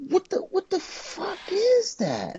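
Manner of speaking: very high, slow, incredulous squeal of a question; drawn-out high disbelief, rising at the end; retold disbelief. Acting out own shocked reaction.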